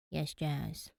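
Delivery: soft, low, sulky reply; a scolded sibling giving in; mildly acted dejected yes. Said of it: "in the same dejected tone".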